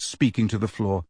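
plain reading voice

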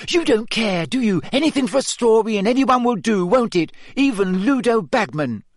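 high, fast furious accusation; angry. A narrator's reading. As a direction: lash out at the reporter. Hermione attacks Rita.

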